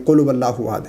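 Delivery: هو started with a w sound, no ہا; wrong pronunciation. Shown as a demonstration. Teacher imitates students starting هو with waw. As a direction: rush قل هو so it sounds like "qul wo". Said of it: "یہ غلط ہے".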